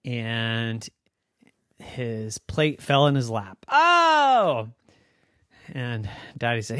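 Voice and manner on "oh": high loud oh of dismay; startled cry at the mess; dismayed yelp. Acted. Plate falls in lap.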